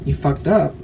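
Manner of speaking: usual speaking voice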